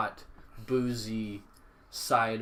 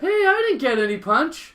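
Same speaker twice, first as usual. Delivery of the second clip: put-on very high, loud little kid voice; little boy whine; 8 dB louder than usual. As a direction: squeak it like a small boy left out. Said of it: "Iron Man or something goes".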